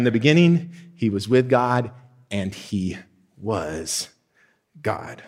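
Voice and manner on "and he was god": soft, low, word by word; hushed dramatic pause. Acted. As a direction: drop the voice and let each word land. Climax of the verse.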